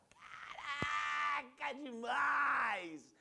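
huge high shout with vowels stretched very long; hammed-up ecstatic yell; overwhelmed exclamation. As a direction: bellow it in drawn-out amazement. Acts overwhelmed reaction to art.

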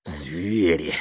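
understated weary sigh. A narrator's reading. Tamara driving away from the crowd, sighing. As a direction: breathe the word out with a sigh. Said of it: "вздыхала Тамара".

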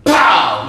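explosive, loud "pow"; loud gunshot imitation. Storyteller imitating the king's rifle shot, explosive. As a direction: burst it out like a rifle going off.

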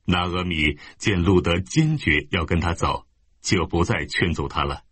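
ordinary delivery, nothing marked

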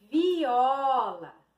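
stretched model "viola"; drawn-out word reveal. Shown as a demonstration. Teacher revealing a word to pupils, drawn out. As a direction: present it clearly, lengthening the vowels.

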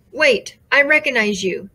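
ordinary delivery

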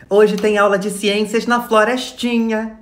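slow, stretched-out schoolteacher voice; teacher's announcement. Acted. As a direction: announce it slowly to a class of children. Acted teacher announcing the class.

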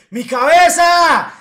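high, loud, slow mock shriek; retold scream. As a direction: imitate her crying out in pain. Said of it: "Esa vieja estaba gritando".

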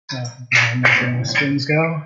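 usual speaking voice

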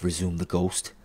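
ordinary delivery

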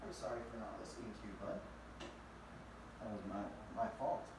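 quiet, quick murmured sorry; hushed apology to the dog; soft apology to dog. Real, not acted. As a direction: apologise gently under the breath.

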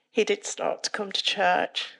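normal talking voice

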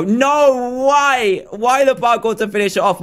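high, drawn-out cry of dismay; dismayed outcry. Real, not acted. Player learning parkour is the last game, dismayed cry. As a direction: wail in protest at bad news.

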